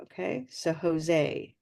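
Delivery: slow Jose ending in a gliding ay; gray-vowel Jose; English Jose. Shown as a demonstration. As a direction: draw out Jose with a gliding end. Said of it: "it becomes gray".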